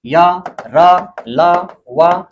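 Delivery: slow letter recitation. Shown as a demonstration. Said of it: "पांचवा अक्षर".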